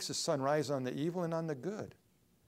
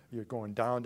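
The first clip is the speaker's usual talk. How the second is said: normal talking voice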